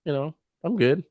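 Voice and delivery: high, casual shrug-off voice; unbothered UFO. Pretend. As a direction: brush it off like it's nothing. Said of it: "It's like".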